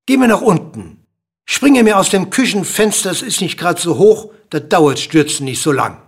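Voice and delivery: loud, high order easing off toward the end; commanding. A narrator's reading. King ordering the dwarf to the kitchen window, commanding.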